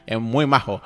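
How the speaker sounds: low, loud put-on Seville Spanish accent; playful Castilian Spanish accent from Seville; Sevilla accent. Shown as a demonstration. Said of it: "that Spanish accent from Sevilla".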